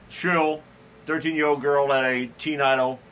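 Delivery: usual speaking voice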